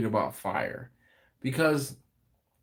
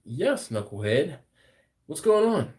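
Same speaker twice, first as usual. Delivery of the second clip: a hint of a work phone voice; 4 semitones higher than usual. Answering Knucklehead's call at work, light phone voice. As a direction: lightly imitate your on-the-job phone manner. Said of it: "I kinda do have that phone voice".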